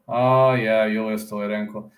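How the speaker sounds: ordinary delivery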